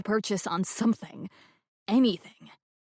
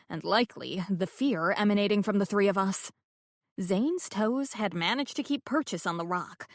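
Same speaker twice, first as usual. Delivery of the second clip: normal talking voice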